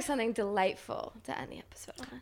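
unvoiced, hushed suggestion; a soft conspiratorial whisper. Real, not acted. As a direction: whisper it as a sly aside.

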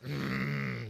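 creepy throaty "mm"; creepy grunt of relish; Carl. Retold or imitated. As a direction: hum it like a leering oddball. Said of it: "Reminds me of Carl from, uh, Sling Blade".

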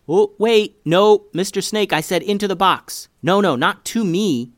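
high, loud, worried pleading; alarmed protest. Acted. Boy begging a snake to stay away from him, alarmed.

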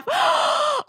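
gasp. Real, not acted. Mock horror at the stranger.

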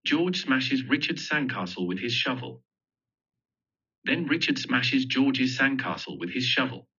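usual speaking voice

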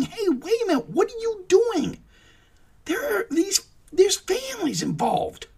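high squealing mock protest, far above his own voice; mock screaming. Mocking how the agency should be screaming, high squeal. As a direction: shriek it like a pretend panicked activist. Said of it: "yelling, screaming".

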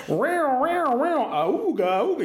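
high, hyped morning radio goofing; morning radio host. Retold or imitated. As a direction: ham up a zany radio DJ. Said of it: "Mark makes fun of that shit about, like, morning radio things".

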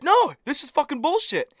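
high, heated retold outburst; mock pissed off. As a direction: imitate a friend losing his temper. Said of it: "actually getting pissed".

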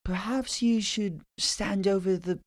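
high-pitched, pleasant English lady; imitated British landlady, pitched well up; calm British landlord. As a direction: suggest it serenely in a put-on British accent. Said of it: "She said it so calmly".

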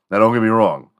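normal talking voice

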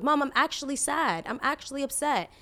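mock earnest child's plea; imitated pleading child. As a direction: plead like an upset kid, lightly acted. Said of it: "the kid is pleading with her".

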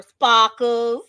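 loud imitation Boston accent; punchy put-on Boston jeer. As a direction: shout the nickname in mock Boston. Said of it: "between the Boston accent".

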